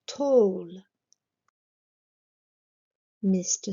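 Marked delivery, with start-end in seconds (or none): 0.1-0.8 "tall" with a long "aw" vowel, not "a"; careful pronunciation. Shown as a demonstration. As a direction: model "tall" as "tawl", drawn out clearly. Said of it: "alors qu'il se prononce".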